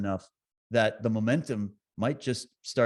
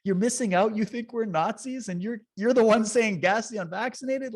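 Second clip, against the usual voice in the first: mock pity; 6 semitones higher than usual. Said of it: "And I went".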